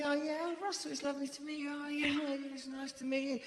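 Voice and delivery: quick, soft, high little voice for Liam's wife; imitating her, soft and fast. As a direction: mimic her breathy politeness at speed. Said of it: "So she's going".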